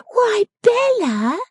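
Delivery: very high, loud, drawn-out surprise; astonished exclamation. A narrator's reading. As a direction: squeal it slowly in disbelief. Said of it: "exclaiming again".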